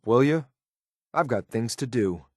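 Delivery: ordinary delivery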